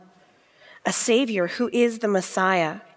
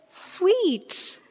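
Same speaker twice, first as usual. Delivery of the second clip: cooing; 11 semitones higher than usual. Acted. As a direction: coo it tenderly. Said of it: "they cooed".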